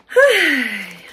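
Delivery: long tired exhale; heavy sigh. Real, not acted. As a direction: sigh out of breath. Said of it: "I'm out of breath".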